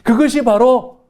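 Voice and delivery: rising, building sermon cadence; fervent preaching. Acted. Pastor preaching to the congregation, building fervour. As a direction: preach it to the congregation with growing fire.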